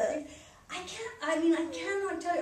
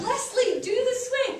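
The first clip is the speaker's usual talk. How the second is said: high, raised mock call; imitated group shouting; 11 semitones higher, 5 dB louder than usual. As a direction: imitate kids calling up to egg someone on. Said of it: "And they all go".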